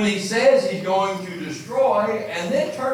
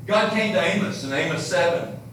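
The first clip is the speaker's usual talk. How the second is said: ordinary delivery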